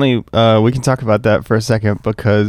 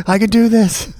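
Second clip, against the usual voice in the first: high, giggly copy of the mantra; mocking brother; 12 semitones higher than usual. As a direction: tease him by parroting his mantra. Teasing brother with his mantra.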